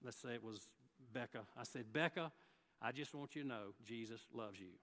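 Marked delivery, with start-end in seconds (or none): 1.9-4.8 gentle word. Retold or imitated. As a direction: tell her gently, one to one. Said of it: "Now, I could have sat there and ... said".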